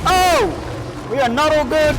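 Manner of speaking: panicked high yell as he drops; screaming while falling. Real, not acted. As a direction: shout in real alarm, falling. Falls while crossing a gap.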